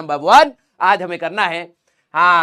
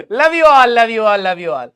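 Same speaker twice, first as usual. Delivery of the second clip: fading affection; 5 dB louder, much slower than usual. Real, not acted. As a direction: say it warmly, letting it die away. Affectionate sign-off to students. (प्यार से, धीरे धीरे मद्धम होते हुए)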